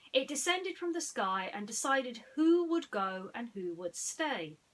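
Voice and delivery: ordinary delivery, nothing marked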